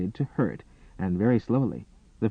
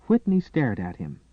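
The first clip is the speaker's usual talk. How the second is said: ordinary delivery, nothing marked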